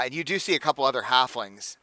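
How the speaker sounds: usual speaking voice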